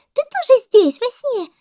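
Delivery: very high, loud monkey voice; excited monkey cry. A narrator's reading. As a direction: squeal with surprise at the meeting. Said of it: "закричала мартышка с пальмы". (мартышка, восторженно, громко и тонко)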